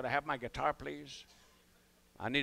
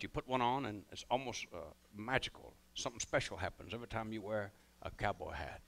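ordinary delivery

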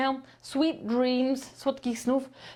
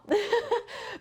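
laugh; 11 semitones higher than usual. Real, not acted. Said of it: "nie nakrzyczałam za bardzo".

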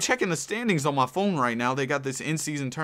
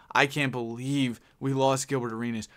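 normal talking voice

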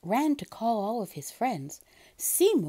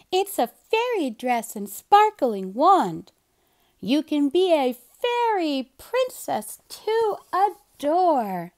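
put-on louder, higher girlish pitch; eager Kitten; 6 semitones higher, 6 dB louder than usual. A narrator's reading. Kitten offering Seymour a fairy dress, eager and loud. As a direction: sell the fairy dress with delight.

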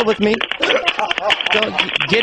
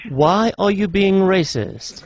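slow, measured operator accusation; automated phone menu; much slower than usual. Pretend. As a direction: accuse the caller in a drawn-out menu voice.

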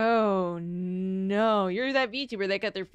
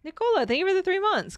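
high, loud excited thanks; 9 semitones higher, 5 dB louder than usual. Real, not acted. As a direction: gush with thanks.

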